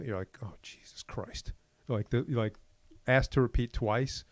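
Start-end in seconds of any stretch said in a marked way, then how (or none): 0.4-1.5 slow, under-the-breath imitated fury; mock exasperation. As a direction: act out a seething, drawn-out curse, softly. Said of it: "it's like immediate fury".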